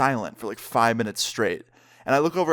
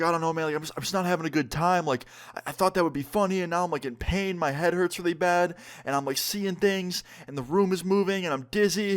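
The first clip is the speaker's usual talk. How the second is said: imitated crying friend; 5 semitones higher than usual. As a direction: plead miserably in a raised pitch, without real sobs. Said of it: "just hysterically crying".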